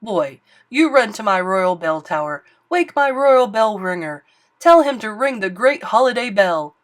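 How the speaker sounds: loud, bossy orders; king commanding his page; royal command. Acted. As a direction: order the boy about.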